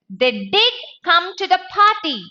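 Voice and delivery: DID punched, line pitched high; firm insistence landing on DID; stress on DID. Shown as a demonstration. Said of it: "I want to give emphasis".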